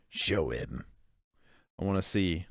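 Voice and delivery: low mock growl. To the video, demanding to see the man, low mock growl. Repeats it in another voice.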